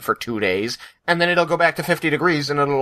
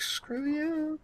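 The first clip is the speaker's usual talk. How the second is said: high, soft, playful mock put-down; mock retort; 10 dB quieter than usual. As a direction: toss it off high and soft like a joke insult. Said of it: "I'm like".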